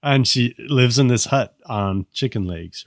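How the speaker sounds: normal talking voice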